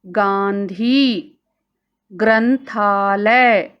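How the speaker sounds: गांधी and ग्रंथालय drawn out slowly with the nasal clear; slow model words. Shown as a demonstration. Teacher to children, slow model reading. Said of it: "अनुस्वार वाले शब्द देखिए".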